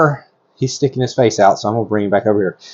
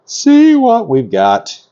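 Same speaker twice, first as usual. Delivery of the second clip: high, drawn-out excited sing-song; 8 semitones higher, much slower than usual. Real, not acted. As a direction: stretch it out high with giddy anticipation, then drop. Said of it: "Sorry, I'm a little excited".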